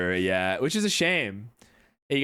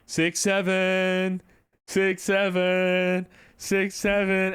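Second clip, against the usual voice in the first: teasing sing-song call, drawn out; taunting; 6 semitones higher, slower than usual. Retold or imitated. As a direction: goad him with a slow taunting chant. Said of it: "and I was like".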